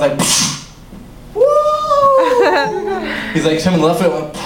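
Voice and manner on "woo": high, drawn-out mock kung-fu "woo"; mock karate cry. Comic retelling a kick from dad, mock karate cry. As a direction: let out a squealing pretend fight cry.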